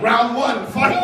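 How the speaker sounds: loud, high round-start call; fight game announcer. Acted. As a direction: announce the bout like a video game.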